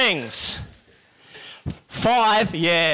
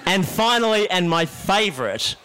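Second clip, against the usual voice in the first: usual speaking voice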